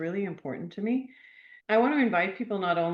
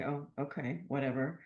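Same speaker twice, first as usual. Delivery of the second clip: low, softer, unimpressed brush-off; dismissive eye-roll; 3 semitones lower, 6 dB quieter than usual. Retold or imitated. Sceptics rolling their eyes at the advice, dismissive. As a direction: imitate a sceptic shrugging it off. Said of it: "people who roll their eyes".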